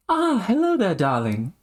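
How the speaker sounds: theatrical high-pitched hello; affected greeting. Acted. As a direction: greet a darling in a high put-on voice. Greets as a character.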